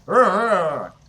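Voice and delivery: loud wordless goblin roar; goblin growl. Acted. Game master gives the goblin's cry in Goblin, a loud growl. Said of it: "it's in Goblin. It goes".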